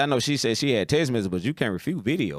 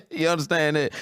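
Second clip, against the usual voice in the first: laughing; 6 semitones higher than usual. Real, not acted. Laughing at own joke.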